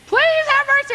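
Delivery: high, loud wailing plea; desperate pleading. Retold or imitated. The servant begging the king for mercy, high and wailing. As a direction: beg like a man about to lose everything.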